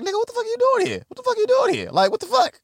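high, fast indignant squawk; mock outrage. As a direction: blurt out the shock fast and shrill-high.

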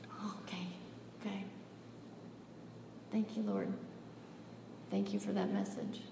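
hushed, near-whispered submission; meek acceptance. Retold or imitated. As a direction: answer very softly, as if chastened. Meek reply to God's scolding.

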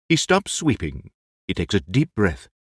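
normal talking voice

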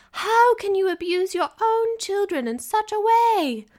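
high, loud, shrill complaint; shrill mother. Acted. Wife shrilly scolds husband over the children, high and loud. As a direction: scold him in a piercing shriek. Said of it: "her voice shriller by the second".

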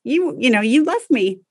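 imitating a toddler mad at mom. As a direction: voice the sulky child. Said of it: "He's like".

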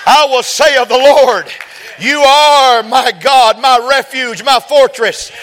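loud, high, slowed proclamation; preaching at full force. Real, not acted. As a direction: shout the verse out in triumph. Sermon climax, loud and high.

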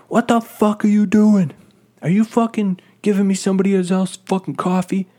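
high, loud mock outrage; angry customer. Old customer accusing the worker over his coffee, shouted indignation. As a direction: imitate an irate old man yelling from across the counter.

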